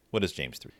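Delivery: plain reading voice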